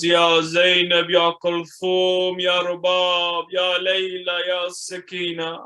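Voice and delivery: slow lamenting roll call; calling out names. Acted. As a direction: call each name slowly, fading. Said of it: "and he calls out".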